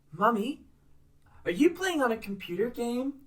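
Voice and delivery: put-on high, sweet little girl. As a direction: play the curious small daughter. Voicing the little daughter.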